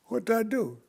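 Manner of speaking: high, alarmed "What did I do?"; startled question. Retold or imitated. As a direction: jump up in pitch, caught off guard. Son startled by father's threat.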